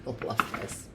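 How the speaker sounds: soft whispered I love this. Real, not acted. Reader delighting in the Scorpio reading, whispered. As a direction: breathe it out as a secret. Repeats the phrase then whispers it.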